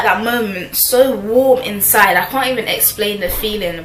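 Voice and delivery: ordinary delivery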